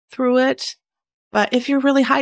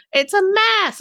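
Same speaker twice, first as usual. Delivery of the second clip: high, bright exclamation; exclaiming; 10 semitones higher than usual. Real, not acted. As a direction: shoot it up in mock dismay. Exclaiming at her messy desk.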